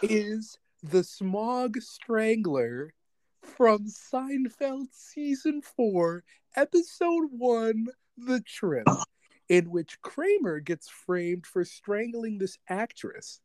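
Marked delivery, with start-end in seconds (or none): 0.0-8.9 slow, higher, deliberate; dramatic reveal. Real, not acted. Host unveils the Seinfeld link, slow and higher. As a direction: draw out each word like a grand reveal.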